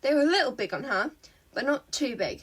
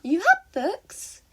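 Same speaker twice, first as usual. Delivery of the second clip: put-on high girl voice; 6 semitones higher, 5 dB louder, much slower than usual. A narrator's reading. Himiko voiced high in story.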